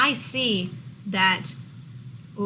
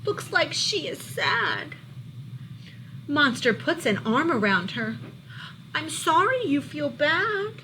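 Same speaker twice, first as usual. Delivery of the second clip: lively, louder storybook read-aloud; reading to children; 4 semitones higher, 4 dB louder than usual. A narrator's reading. Teacher reading a monster book to her class, lively storybook voice. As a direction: bring the picture book alive for the class.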